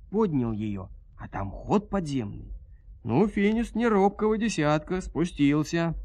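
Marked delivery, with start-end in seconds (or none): none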